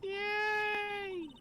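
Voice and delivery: high loud squealed yay; excited cheer. Real, not acted. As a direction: squeal with delight. Cheering at Mia's line.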